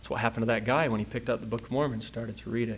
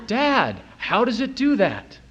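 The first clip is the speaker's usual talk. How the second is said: put-on higher, louder child's question; eager little boy; 9 semitones higher, 4 dB louder, slower than usual. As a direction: mimic a curious kid tugging at dad. Little boy asks his dad.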